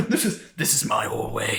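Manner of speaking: put-on British accent slipping into Australian, through laughter; badly faked British chef voice; attempted British accent. Co-host echoing the chef's hallway line, wobbly British accent. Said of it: "try to do a British accent".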